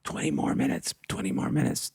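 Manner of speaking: urgent backstage whisper, no voice at all. Retold or imitated. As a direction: breathe it as a hushed whisper. Retold backstage instruction, whispered.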